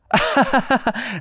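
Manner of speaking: high mimicked giggle; imitated laugh. Showing how the woman reacted, imitated laughing. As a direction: put on her burst of laughter. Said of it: "And she goes".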